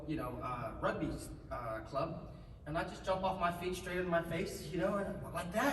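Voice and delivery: ordinary talk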